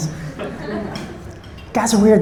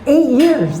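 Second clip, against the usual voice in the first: pitched-up amazed repeat of the answer; surprised echo; 7 semitones higher than usual. Real, not acted. Comedian echoing a couple's answer, amazed. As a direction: sound truly impressed.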